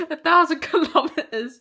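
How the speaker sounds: laughing and welling up at once; laughing through tears. Real, not acted. Telling the vlog about the charity ride, a real laugh-cry. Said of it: "And I think I'm so emotional".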